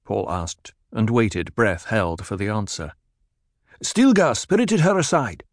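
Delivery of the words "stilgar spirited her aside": lightly voiced lieutenant, higher pitch; voiced Fedaykin. A narrator's reading. Korba telling Paul where Cheney is, lightly voiced lieutenant. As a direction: answer Paul quickly.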